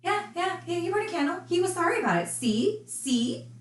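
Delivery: high, sing-song mocking of the mother; mocking imitation. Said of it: "To prove".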